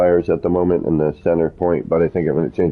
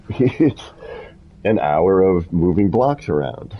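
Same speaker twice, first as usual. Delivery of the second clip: laughing. Real, not acted. Laughs at own tedious work.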